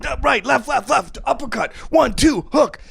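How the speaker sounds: high barked combo calls; coach calling combos. Retold or imitated. Coach calling combos at pads, high barking calls. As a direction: call the punches like a coach. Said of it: "they're always like".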